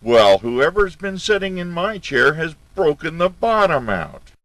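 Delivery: very high, loud little bear wail; angry baby bear. A narrator's reading. Baby bear finds his chair broken, high angry cry. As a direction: shriek it like a furious cub. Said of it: "in a baby bear voice of anger".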